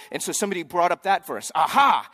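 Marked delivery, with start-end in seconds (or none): 1.5-2.1 loud, punchy gotcha; triumphant aha. Acted. Somebody bringing up that verse, triumphant aha. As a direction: pounce on it as a found proof. Acting out someone producing the verse.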